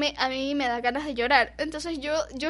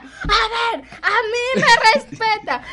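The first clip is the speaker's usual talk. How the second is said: high, loud, very slow demand; teacher demanding respect from the mother; mock stern teacher; 8 semitones higher, 7 dB louder, much slower than usual. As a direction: drag it out like an offended teacher.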